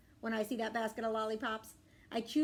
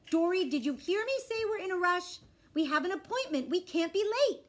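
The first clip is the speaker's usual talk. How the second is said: raised, louder voice of a rushed mom; impatient mom; 8 semitones higher, 6 dB louder than usual. Acted. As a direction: scold a dawdling child, urgently. Hurried mom scolds in story.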